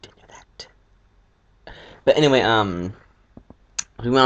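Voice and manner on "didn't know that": mock whispered surprise. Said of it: "be like".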